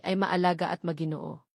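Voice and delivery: ordinary delivery, nothing marked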